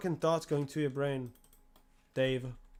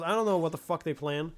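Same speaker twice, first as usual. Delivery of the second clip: ordinary talk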